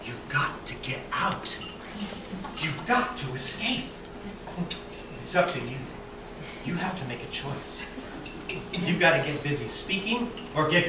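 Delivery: low, near-whispered dream message; dream apparition. Acted. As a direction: urge softly and gravely, with weighty pauses. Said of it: "she was speaking to me in the dream".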